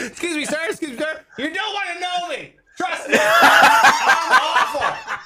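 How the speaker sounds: high, pleading voice rising to a wail; plead, then cry out the last words loud and long; hammed-up self-loathing outburst; mock desperate confession. Mock confession to old stranger.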